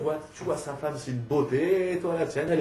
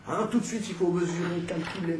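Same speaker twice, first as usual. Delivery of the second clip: higher-pitched mock urgency; soignant pressé imité; 5 semitones higher than usual. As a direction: lightly mimic a fussing nurse, pitched up. Imite les soignants pressés de mesurer. (imitation aiguë de soignant empressé)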